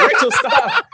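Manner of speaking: high squeal through laughter; laughing plea. Real, not acted. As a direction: beg her to stop while cracking up.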